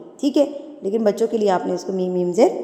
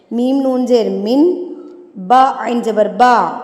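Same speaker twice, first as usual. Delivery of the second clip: drawn-out teacher spelling, letter by letter; slow spelling out; much slower than usual. Shown as a demonstration. Teacher spells a word slowly for her students. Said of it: "آپ کو ہجے کر کے بتاتی ہوں".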